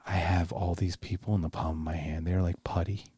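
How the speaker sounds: low, hushed smug puppeteer; understated, low-voiced smugness. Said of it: "that smug look on that guy's face".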